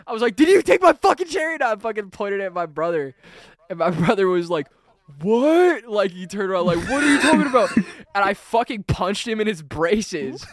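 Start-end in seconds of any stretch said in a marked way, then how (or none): 0.4-1.5 fast, high, loud demand; pissed-off accusation. Retold or imitated. Five-year-old accusing older brother, angry high yell. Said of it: "I was fucking pissed off". 5.2-5.8 high, loud baffled what; indignant surprise. Retold or imitated. Accused brother turning round, loudly baffled. 6.9-7.7 high, loud protest; indignant bafflement. Retold or imitated. Accused brother answering little sibling, high indignant protest.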